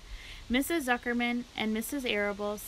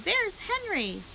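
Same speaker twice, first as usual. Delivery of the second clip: excited; 10 semitones higher, slower than usual. A narrator's reading. Fern spots her friend.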